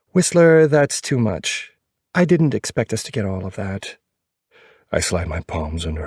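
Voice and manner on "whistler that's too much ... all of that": female character voice. A narrator's reading. Girlfriend protests, narrator voiced.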